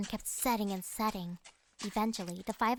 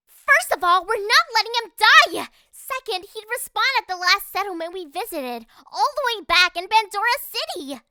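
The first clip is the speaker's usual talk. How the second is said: loud, high-pitched furious rebuke; angry shouting; 12 semitones higher, 14 dB louder than usual. Acted. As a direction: yell your objection at a careless teammate. Narrator's character angrily scolds Cora.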